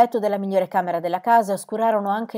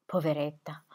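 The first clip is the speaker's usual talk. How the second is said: soft, quiet grieving murmur; tearful pity; 3 semitones lower, 5 dB quieter than usual. A narrator's reading. Squire weeping over the fainted widow, soft pity. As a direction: sigh it with pity. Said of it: "piangendo per la sua sofferenza".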